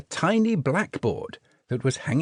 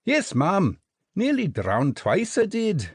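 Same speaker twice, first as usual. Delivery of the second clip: higher, quick Scottish soldier voice; cheerful little Scots private; Scottish jelly baby; 7 semitones higher than usual. A narrator's reading. Jelly baby explains his lineage to Jinx, cheerful Scottish.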